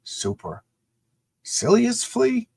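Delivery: very slow, puzzled rising "Supercilious flea?"; reading aloud haltingly. Shown as a demonstration. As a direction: drag out "Supercilious" like a confused kid. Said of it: "struggling to read that word balloon".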